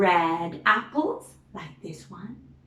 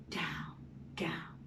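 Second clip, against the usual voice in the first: whisper; 5 semitones lower, 16 dB quieter than usual. Acted. Arrow going down, whispered.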